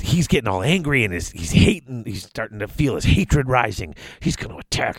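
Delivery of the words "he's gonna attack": hushed, whispered warning. Retold or imitated. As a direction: whisper it like building suspense. Building up the hero's rage.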